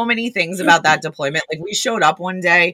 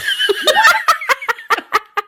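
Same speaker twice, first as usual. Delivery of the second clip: long, high cackling laugh; helpless high-pitched laughing fit; high burst of laughter. Real, not acted. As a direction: crack up laughing at your own story. Cracks up at her own story.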